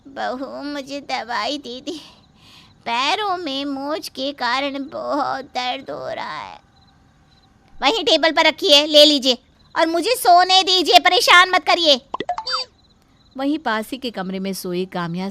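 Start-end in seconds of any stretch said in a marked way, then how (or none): 0.1-6.6 pained plea. Acted. Injured mother-in-law asking daughter-in-law for medicine, weak. 7.7-12.0 louder, higher, curt dismissal; irritated brush-off. Acted. Daughter-in-law brushing off the mother-in-law at night, loud.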